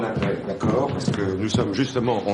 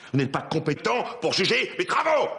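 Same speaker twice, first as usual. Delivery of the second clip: higher, affronted protest; indignant outburst; 7 semitones higher than usual. Acted. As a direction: snap back, voice climbing in outrage. Offended in a quarrel with a colleague. (protestation outrée)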